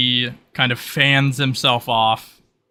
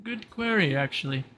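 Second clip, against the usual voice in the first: lightly voiced, higher-pitched adviser; a hint of a higher character voice; character musing aloud; 9 semitones higher than usual. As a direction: muse over the question in a raised pitch. Character ponders request for advice.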